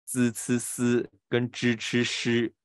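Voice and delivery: 滋、呲、丝 flat-tongued, then 支、吃、诗 curled; evenly chanted contrast of 滋、呲、丝 with 支、吃、诗; flat versus retroflex. Shown as a demonstration. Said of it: "把这个念对".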